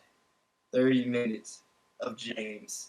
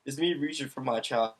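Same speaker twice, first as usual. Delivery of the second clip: mock wail; 2 semitones higher, 11 dB louder than usual. Mock-sad outburst about childhood.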